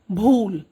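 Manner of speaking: भूल with a loud burst of breath on भ; aspirated bhool. Shown as a demonstration. Teacher demonstrating the paper activity, भूल breathy and loud. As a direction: blow the भ of भूल so the paper strip flies. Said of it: "जब मैं ये भूल बोल रही हूँ तो ये उड़ेगा".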